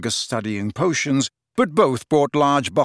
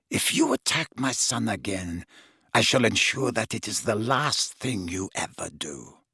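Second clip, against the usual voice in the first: low, soft, icy threat; cold aristocratic mother; 3 semitones lower, 5 dB quieter than usual. A narrator's reading. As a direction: menace him quietly, every word measured. Narcissa threatens Harry.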